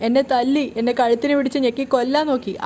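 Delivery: plain reading voice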